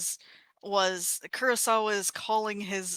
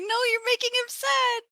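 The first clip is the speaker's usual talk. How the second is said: mock distressed protest. Viewer protesting the character looking so sad, high mock distress. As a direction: plead in a much higher mock-upset voice.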